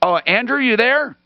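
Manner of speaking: ordinary talk, nothing marked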